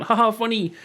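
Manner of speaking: usual speaking voice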